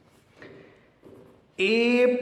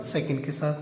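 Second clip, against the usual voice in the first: softer, lower repeat; soft echo; 4 semitones lower, 6 dB quieter than usual. Shown as a demonstration. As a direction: echo second के साथ quietly. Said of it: "second को second के साथ".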